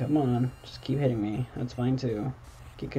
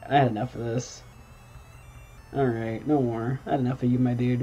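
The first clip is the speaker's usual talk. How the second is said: ordinary delivery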